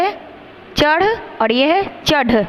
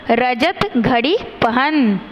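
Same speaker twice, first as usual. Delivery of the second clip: put-on रजत घड़ी पहन spoken slowly for children to repeat; slow model sentence; much slower than usual. Shown as a demonstration. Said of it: "मेरे साथ दोहराएंगे". (बच्चों के लिए धीरे-धीरे बोलिए)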